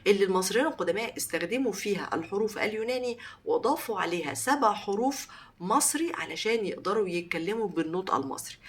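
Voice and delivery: usual speaking voice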